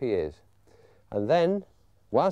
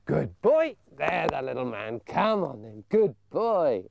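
praising the puppy; slower than usual. Shown as a demonstration. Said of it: "praising pup after fetch".

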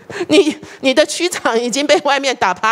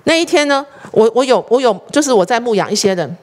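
ordinary talk

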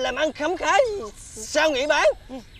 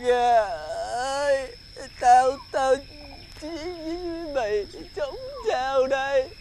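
crying; 6 dB quieter than usual. Acted. Weeping over the chickens.

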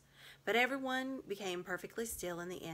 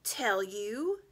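higher, louder sneering witch; shriller witch; 4 semitones higher, 8 dB louder than usual. A narrator's reading. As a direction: spit it back in scorn. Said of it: "her voice growing suddenly shriller".

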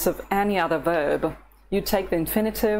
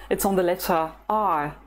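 ordinary delivery

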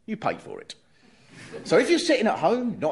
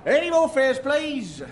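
lightly raised, higher call for fares; conductor calling out; 7 semitones higher, slower than usual. Acted.

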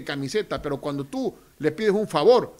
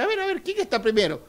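mock mayor; 8 semitones higher than usual.

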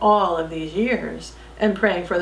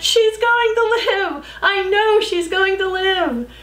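joyful shout; 11 semitones higher, 3 dB louder than usual. Acted. Tekla shouting in relief that Jo will live, high and loud. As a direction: announce it to everyone, overjoyed. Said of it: "Tekla shouted".

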